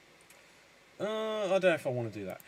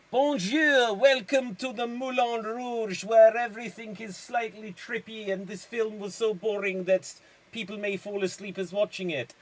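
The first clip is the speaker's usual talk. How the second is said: lightly put-on French cabaret host; raised, showy welcome for a mock film remake; 5 semitones higher than usual. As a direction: play a hammed-up emcee.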